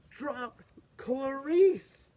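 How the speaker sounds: ordinary delivery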